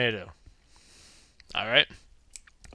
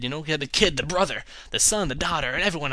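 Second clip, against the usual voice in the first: normal talking voice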